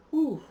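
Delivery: big shaky exhale, high and loud; loud whew. Real, not acted. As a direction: blow it all out in one loud breath. Overwhelmed after emotional song.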